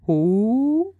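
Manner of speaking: a drowsy owl calling down from a tree; owl asking. A narrator's reading. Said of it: "Stare asked from high up".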